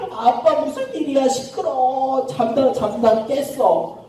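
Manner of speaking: high, drawn-out sleepy whine; woken child. Acted. Son woken at midnight complaining to dad, whiny.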